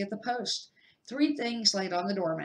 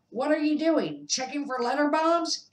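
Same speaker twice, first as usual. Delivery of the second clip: higher, louder mocking call; loud mocking jibe; 8 semitones higher, 6 dB louder than usual. A narrator's reading. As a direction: tease him loudly for a laugh. Vernon mocking from kitchen.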